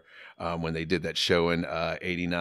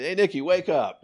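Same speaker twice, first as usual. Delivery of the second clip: 1 loud wake-up call; 11 semitones higher, 8 dB louder than usual. Retold or imitated. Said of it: "He goes".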